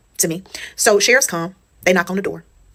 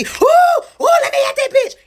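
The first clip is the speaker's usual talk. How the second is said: much higher and louder shrieking yell; screaming fury; 4 dB louder, slower than usual. Retold or imitated. Mother-in-law outside the slammed door, shrieking to get at her. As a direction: shriek like you want to fight. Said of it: "Acting a fool outside".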